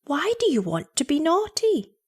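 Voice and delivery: high, puzzled mother's question; astonishment. A narrator's reading. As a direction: ask in mild amazement. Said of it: "said the mother in astonishment".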